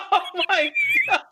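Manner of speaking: high, laughing exclamation. Real, not acted. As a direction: crack up while saying it. Laughing at the singing.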